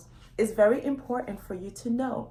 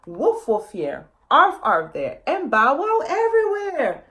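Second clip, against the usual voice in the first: loud, higher, sing-song woofs and arfs; mock dog barking; 5 semitones higher, 6 dB louder than usual. A narrator's reading. Elephant family calling for lost puppy, playful loud barking. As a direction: bark it out like a big family calling a lost pup.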